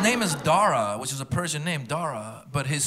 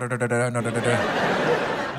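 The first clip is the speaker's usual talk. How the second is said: put-on Indian accent rhythm; 6 semitones lower, slower than usual. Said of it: "she doesn't do the hard".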